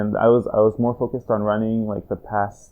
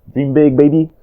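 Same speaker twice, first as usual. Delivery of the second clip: hyped up; 5 semitones higher, 9 dB louder than usual. Acted. Hyping the shared gym dream.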